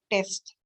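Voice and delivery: "टेस्ट" with a short, unstretched e; short vowel. Shown as a demonstration. Teacher demonstrating a closed syllable, "टेस्ट" with short vowel. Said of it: "short होगा".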